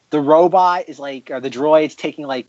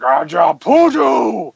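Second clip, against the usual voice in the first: Jabba voice; 4 dB louder, much slower than usual. Retold or imitated. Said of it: "Jabba's like".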